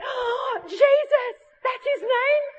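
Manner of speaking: high gasping astonishment, acted out; amazed. Girl hearing the name Jesus from the preacher, high amazed gasp. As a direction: imitate a girl stunned by a revelation, very high.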